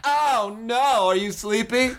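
play-acted sleepy-time concern; mock coaxing. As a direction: coo it in a high pretend voice. Playing along with sleep roleplay.

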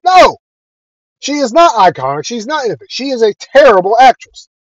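high, heated comeback that starts with a sharp No; heated retort. Retold or imitated. Retorting to fans who defend her.

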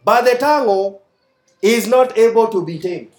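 loud, emphatic preaching read; scripture reading. Acted. Preacher reads James to the congregation, forceful. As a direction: preach the verses at full force.

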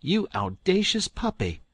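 high loud indignant cry; outraged. Acted. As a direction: scold him after the kiss. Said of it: "jumped up and wiped it off".

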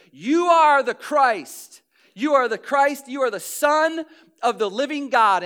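loud, high proclamation as Peter; bold declaration. Acted. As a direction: speak up boldly and impulsively, ringing it out. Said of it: "Peter speaks up and says".

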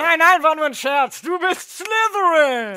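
hammed-up bellowed announcement; shouted again. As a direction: shout the verdict, stretching the final name. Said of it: "da rief der Hut wieder".

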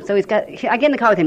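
ordinary talk, nothing marked

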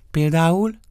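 high, loud, quick question; prompting challenge. Acted. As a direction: demand an example, pitched right up. Eva challenges him for an example.

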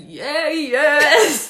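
hyped bro cheer. Retold or imitated. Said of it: "they're like".

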